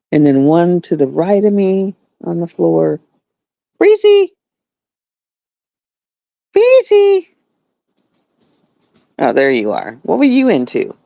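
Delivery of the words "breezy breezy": high, loud call to the dog. Real, not acted. Calling her dog Breezy to come. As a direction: call the dog's name across the house.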